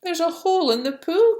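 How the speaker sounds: put-on high, loud children's alarm; excited children's cry. A narrator's reading. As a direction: call out like startled kids. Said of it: "cried the children".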